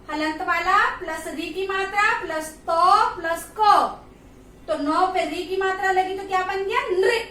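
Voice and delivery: normal talking voice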